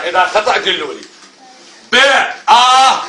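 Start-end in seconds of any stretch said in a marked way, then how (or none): 1.9-3.0 ب then آ, each sounded separately; spelling out sounds. Shown as a demonstration. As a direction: spell it out: ب, pause, آ. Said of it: "راح أتهجى أنا".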